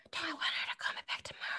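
hurried whisper, hidden from the phone; whispered aside. Acted. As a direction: whisper quickly to the group for advice. Aside to group during phone call.

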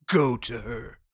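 a deep voice urging him to go to her; low dramatic voice. Retold or imitated. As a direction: urge him softly, deep.

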